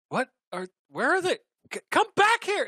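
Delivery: rising to a high loud call; calling after them. Acted. Girls calling the orcs back, rising high shout. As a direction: yell after them as they run.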